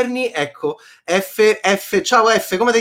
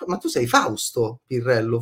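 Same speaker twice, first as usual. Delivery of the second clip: usual speaking voice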